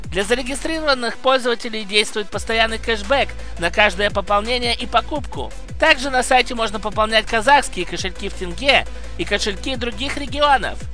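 brisk upbeat sponsor read. Acted. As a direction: sell it like a quick promo. (рекламная подача)

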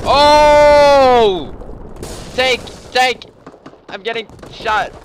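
high, loud screaming alarm; panicked shout. Real, not acted. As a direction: yell it in a panic. Tank appears, being shot.

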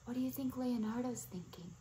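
ordinary delivery

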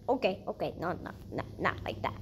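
very soft, low self-talk; hushed muttering. Real, not acted. Player correcting the move, hushed muttering. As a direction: murmur it under your breath.

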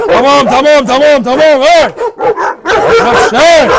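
loud, high repeated commands; urgent loud calls to settle the dog; shouting at the dog. Real, not acted. Calming an aggressive dog.

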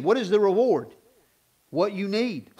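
ordinary delivery

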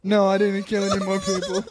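ordinary talk, nothing marked

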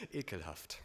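low, quiet, dry aside; understated, low-voiced disgust; deadpan disgust. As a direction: drop it dryly after the gush. Dry undercut after gushing.

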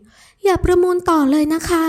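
put-on high, slow, coaxing girl's voice; pleading young woman. Ramon begs her lover to stop bidding, high pleading. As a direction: beg sweetly, drawn out and high.

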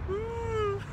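high, drawn-out woo; long high whoop; happy woo. Real, not acted. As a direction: let out a high little cheer. Cheer after leaving the tower.

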